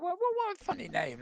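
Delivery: high squeaky mock bafflement; mock puzzled thought. Host retelling first reading the odd name, squeaky puzzlement. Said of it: "I thought".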